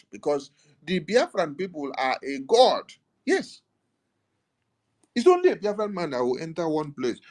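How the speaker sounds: high-pitched, preacher-like proclamation; impassioned oratory. Real, not acted. Speaker exalting the people as God's own, impassioned oratory. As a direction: proclaim it with rising fervour, pitched well up.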